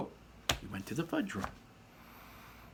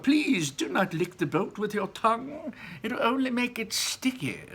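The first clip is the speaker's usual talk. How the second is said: very high, louder prim warning; Wonka scolding; 12 semitones higher, 4 dB louder than usual. A narrator's reading. Wonka warns Mike off licking the boat, very high. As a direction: chide him, high and sing-song. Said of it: "shouted Mister Wonka".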